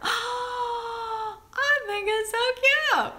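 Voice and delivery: gasp, then a slow, high gush of delight; excited gasp. Real, not acted. Vlogger gushing at the new doll to her viewers, thrilled. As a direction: melt over the tiny doll. Gasps over a cute doll.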